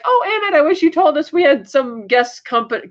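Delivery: high-pitched mock wifely reproach; Emmett's wife. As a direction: pitch it up high, lightly scolding a husband. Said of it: "Emmett's wife is like".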